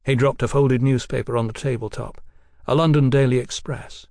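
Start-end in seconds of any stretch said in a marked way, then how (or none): none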